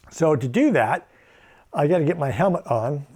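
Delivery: normal talking voice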